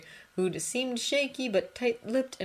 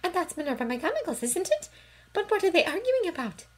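put-on high anxious girl's voice; puzzled and uneasy, pitched high; worried girl; 13 semitones higher than usual. A narrator's reading.

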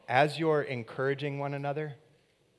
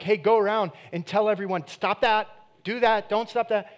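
mock scolding; 8 semitones higher, 8 dB louder than usual. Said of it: "He's not like".

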